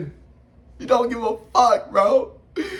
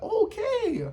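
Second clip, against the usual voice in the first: surprised okay; 13 semitones higher, 5 dB quieter than usual. Retold or imitated. Megumi reacts to kick.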